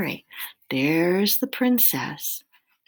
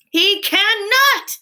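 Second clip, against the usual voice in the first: slow, high, loud protest; despairing outburst; 9 dB louder, slower than usual. A narrator's reading. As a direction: wail it out slowly and loudly. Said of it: "in despair".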